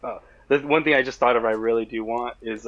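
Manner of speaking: normal talking voice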